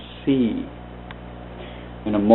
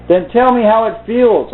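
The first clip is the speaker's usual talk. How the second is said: exasperated; 10 semitones higher, 3 dB louder than usual. A narrator's reading. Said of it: "growing exasperated".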